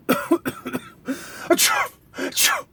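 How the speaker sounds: very high squeaky sneezes. Acted. Reindeer sneezing while flying away. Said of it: "Coughing and sneezing all the way".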